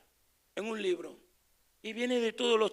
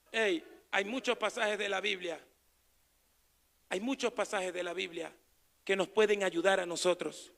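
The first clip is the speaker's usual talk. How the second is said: ordinary talk, nothing marked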